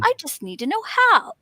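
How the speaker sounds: high-pitched, eager resolve; hopeful realization. Retold or imitated. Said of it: "that turning point".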